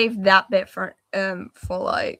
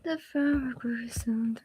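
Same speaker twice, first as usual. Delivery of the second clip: soft whispered aside; 2 semitones higher, 7 dB quieter, slower than usual. Real, not acted. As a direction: whisper it to yourself. Quiet aside while drawing.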